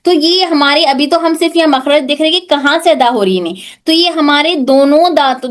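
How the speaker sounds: normal talking voice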